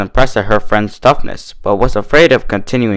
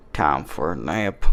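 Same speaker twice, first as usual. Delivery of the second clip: low, soft, slow mumble; sleepy mutter; 7 semitones lower, 10 dB quieter, slower than usual. A narrator's reading. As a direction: drift off as you say it. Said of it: "she muttered".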